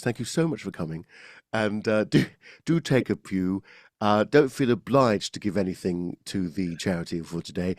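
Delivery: open, chest-resonant vicar. Shown as a demonstration. Vicar thanking people for coming to a charity event, empathic chest voice. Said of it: "this is my vicar voice".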